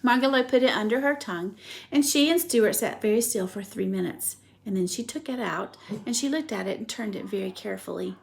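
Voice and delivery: usual speaking voice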